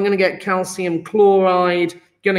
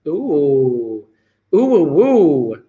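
playful oohs; 4 semitones lower, much slower than usual. Real, not acted. Playful excitement before task.

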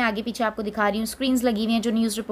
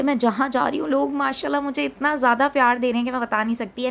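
ordinary delivery

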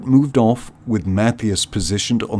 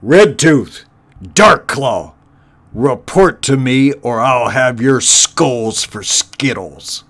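loud, higher barked summons; bellowing threat; 3 semitones higher, 6 dB louder than usual. Acted. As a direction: call out henchmen with menace. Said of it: "he bellowed".

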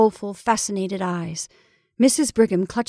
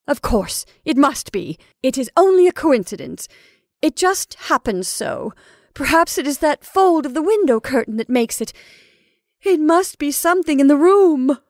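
raised, insistent voice, building louder; anxious insistence; 7 semitones higher, 6 dB louder than usual. Acted. Mrs Brigham explaining away the shadow, anxious. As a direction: talk yourself out of fear.

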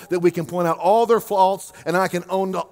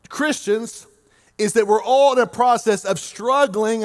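ordinary talk, nothing marked

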